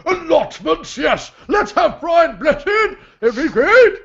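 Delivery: high, loud booming laugh and exclamations; hammed-up hearty roar, laughing through it; Brian Blessed. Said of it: "Imitate this".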